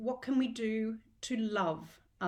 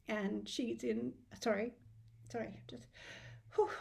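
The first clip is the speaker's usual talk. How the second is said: choking up; 7 dB quieter than usual. Real, not acted. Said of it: "I'll try not to cry again".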